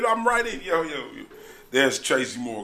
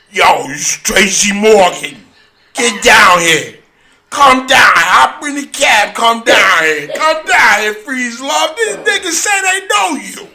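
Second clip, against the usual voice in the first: louder, slowed shouting down the phone; loud excited calling; 8 semitones higher, 10 dB louder than usual. Retold or imitated. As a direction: yell for him to come down.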